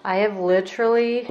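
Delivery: literally stretched and emphasized; stress on literally. Real, not acted. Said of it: "yes, I am emphasizing that word".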